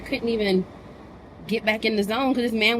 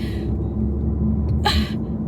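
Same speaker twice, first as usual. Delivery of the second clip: exasperated sigh. Real, not acted. Exasperated at the man.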